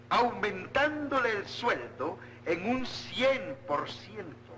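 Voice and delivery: slow, official proclamation voice; formal decree reading. Acted. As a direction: read it like a town crier. Reads an official decree aloud.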